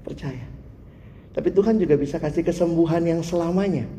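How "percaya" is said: faint reply. Retold or imitated. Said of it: "Percaya itu".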